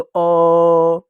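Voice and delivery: अ held long on a level note; sustained अ. Shown as a demonstration. Teacher letting children hear the vowel inside, drawn-out अ. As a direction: let अ ring on as a long vowel. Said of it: "सुनाई देगा".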